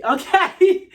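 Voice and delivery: high, loud, laughing okay. Real, not acted. Teasing her viewers about the outfit, laughing. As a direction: laugh it out as you ask.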